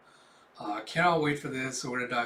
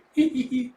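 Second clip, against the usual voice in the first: short, high, put-on giggle; 9 semitones higher, 6 dB louder than usual. [name] apologizing for not telling, high acted giggle. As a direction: titter after the apology, high-pitched.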